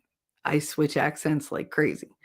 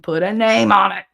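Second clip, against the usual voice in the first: loud, raised catchphrase delivery; quoting Lead; 2 semitones higher, 5 dB louder than usual. Retold or imitated. Streamer quoting a catchphrase at chat, loud and raised. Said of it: "To quote Lead".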